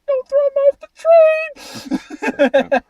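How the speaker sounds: high, loud panicked yell. Retold or imitated. Imagined viewer panic at the scene.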